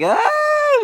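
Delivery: a very high release yell; purging scream of release; cathartic high cry. Real, not acted. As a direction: let it all out in one sharp high cry. Energy being purged out.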